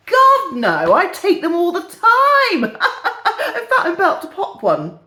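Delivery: hammed-up enthusiasm, laughing; over-keen pharmacist. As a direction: blurt it out with a laugh breaking through. Joke punchline as the pharmacist.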